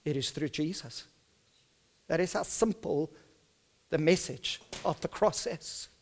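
ordinary talk, nothing marked

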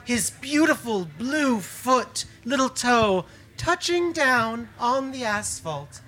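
slow, soft descriptive telling; slow awed narration. A narrator's reading. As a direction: paint the angel in awe. Describes the angel's descent.

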